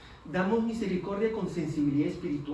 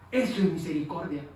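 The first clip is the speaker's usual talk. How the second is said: normal talking voice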